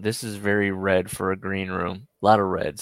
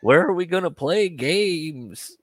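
high, loud, drawn-out mock voice; slow, raised caricature of a friend; imitating a friend; 9 semitones higher, 5 dB louder, slower than usual. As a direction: stretch it out in a hammed-up imitation. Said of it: "What did he say?".

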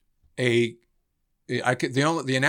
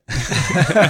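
real laugh; 9 semitones higher, 6 dB louder than usual. Real, not acted. Laughing at coming to.

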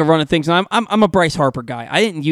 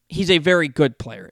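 usual speaking voice

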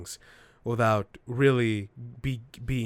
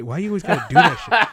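exasperated; 5 semitones higher, 10 dB louder, faster than usual. Real, not acted. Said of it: "I'm surprised that hasn't happened sooner".

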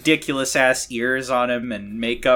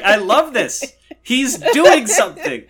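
excited; 10 semitones higher, 6 dB louder, slower than usual. Retold or imitated. Mocking delight at the actor.

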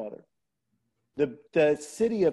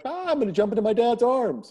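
high, loud imitation of a gleeful toddler; excited little daughter; 8 semitones higher, 5 dB louder than usual. Toddler about to jump to dad in the pool, excited. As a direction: voice a two-year-old calling out, pitched way up. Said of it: "she was two, and she's like".

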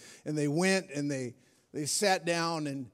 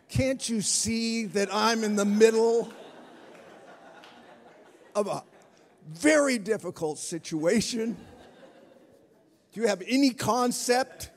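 hammed-up outrage at a small child; stressed father scolding his daughter; exasperated; 6 semitones higher, 7 dB louder than usual. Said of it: "I'm like".

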